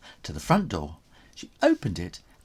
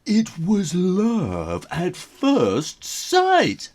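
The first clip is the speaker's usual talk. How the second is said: dreamy; 8 semitones higher, 5 dB louder, much slower than usual. A narrator's reading. Romantic narration.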